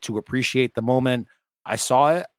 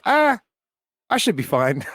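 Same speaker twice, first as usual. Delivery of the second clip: casual imitation; 9 semitones higher than usual. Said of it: "and he's like".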